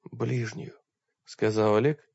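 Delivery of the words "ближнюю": much softer, almost no voice left; barely audible. Acted. Exhausted Oleg answering Targitai almost inaudibly. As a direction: say it so faint you hardly hear yourself. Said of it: "сам едва услышав свой голос".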